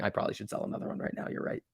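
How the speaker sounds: fast, unvoiced aside to himself; muttered aside. Real, not acted. Trader talking himself into selling, fast whispered aside. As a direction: drop into a whisper, quick.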